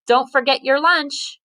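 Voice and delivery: a touch of a mom yelling; mild mock yell. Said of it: "yelled Mom".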